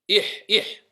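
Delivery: إح avec un ḥ soufflé du fond de la gorge; prononcer ḥa. Shown as a demonstration. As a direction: dire إح, gorge serrée, pas de raclement. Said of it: "C'est pas إخ". (إح bien guttural mais doux, pas إخ)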